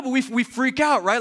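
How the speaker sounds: normal talking voice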